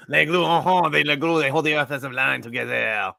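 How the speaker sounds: put-on hammy French Canadian accent; playful Québécois lilt. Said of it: "my France Canadian accent".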